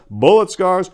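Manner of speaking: plain reading voice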